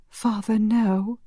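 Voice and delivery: high, slow plea; distressed protest. A narrator's reading. Marielle refuses the priest's last wish, distressed. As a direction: plead against it, pitched high.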